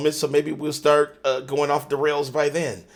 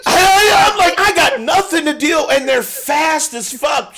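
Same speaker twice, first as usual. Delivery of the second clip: excited retelling; 12 semitones higher, 4 dB louder than usual. Retold or imitated. Retelling the mouse hunt excitedly.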